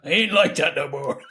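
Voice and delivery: a hint of weary gunslinger; gruff Will impression. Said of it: "repeats Will's line".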